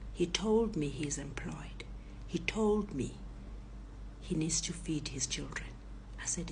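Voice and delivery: usual speaking voice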